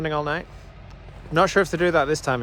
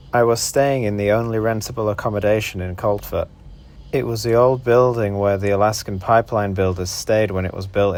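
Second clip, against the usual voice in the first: lower, steady documentary-style narration; travel voiceover narration; 7 semitones lower than usual. A narrator's reading.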